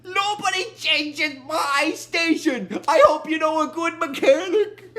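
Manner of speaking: high, loud acted threat; hammed-up irritated warning, words drawn out; annoyed. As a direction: lay down the law in mock anger. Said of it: "Bender gets annoyed with the ship".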